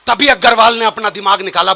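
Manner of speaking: ordinary delivery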